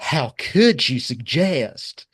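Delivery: put-on high, loud, slow Southern belle accent; hammed-up scandalized plantation accent, vowels drawn out; put-upon plantation owner accent. Host mocking an official's outrage, plantation owner accent. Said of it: "put upon plantation owner accent".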